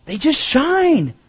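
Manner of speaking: high, slow and drawn out; soaring emphasis. Acted. Preacher to congregation, lifting the lighthouse line high and slow. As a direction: let the line rise and ring, slowly.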